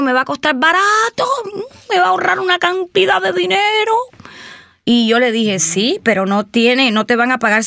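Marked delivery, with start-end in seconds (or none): 0.0-3.9 mock-excited client, voice climbing much higher; imitating the client's eager pitch about saving money. Client's excited quote retold.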